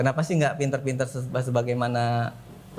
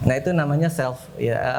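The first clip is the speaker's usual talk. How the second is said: ordinary talk, nothing marked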